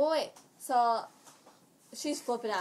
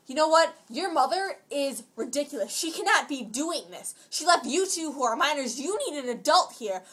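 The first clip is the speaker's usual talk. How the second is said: raised, louder mock tirade; retold yelling; 5 semitones higher, 8 dB louder than usual. Imitating the lady yelling at Nicole about her mother, mock tirade. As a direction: imitate an indignant rant, pitched up. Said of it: "so she's yelling".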